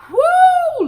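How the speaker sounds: high, loud woo; exasperated woo. Real, not acted. Vlogger overwhelmed by the news, loud high woo.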